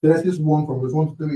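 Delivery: normal talking voice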